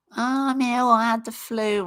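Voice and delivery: high, whiny moaning sick voice. Retold or imitated. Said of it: "I know I sound like a whiny little bitch".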